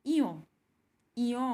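ইয় as a gliding iy sound; teacher repeating the sound; correct sound. Shown as a demonstration. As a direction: glide ইয় from i to y. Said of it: "শুদ্ধ উচ্চারণ হলো".

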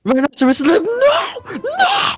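high, loud, rising cries of no; anguished shout. Real, not acted. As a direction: scream in despair at the screen. Watching his unit get beaten.

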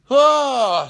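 loud high "ah" falling away; loud high exhale. Real, not acted. Speaker breaking off to viewers about meeting folks, loud high sighing cry. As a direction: let out a big, falling "ah". Wordless cry before changing topic.

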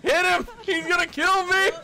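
high panicked cry; scared for your life. Real, not acted. Panicked cry while being chased.

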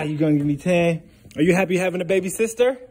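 ordinary talk, nothing marked

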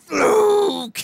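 very high, loud hammed-up Fluke; mock shout. Imitating Roode stepping forward, loud high yell. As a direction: roar it right into the camera. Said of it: "walks up in front and goes".

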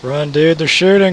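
loud urgent warning; shouting. Real, not acted. Warning teammate of gunfire.

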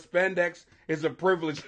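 normal talking voice

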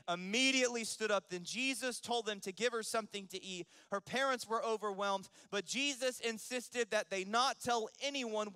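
full-force preaching. Acted. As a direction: preach it high and loud to the congregation. Preaches the Bible story.

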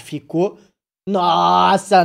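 ordinary talk, nothing marked

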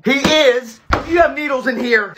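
usual speaking voice

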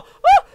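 sudden high piercing scream; terrified scream. Real, not acted. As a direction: shriek in fright. Jump scare scream.

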